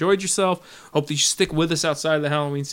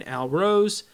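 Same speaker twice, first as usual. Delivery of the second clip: usual speaking voice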